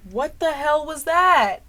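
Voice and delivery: high, loud, slow disbelief; startled outburst. Real, not acted. Vlogger reacting to camera glitch, startled outburst. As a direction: exclaim it loudly, stretched out.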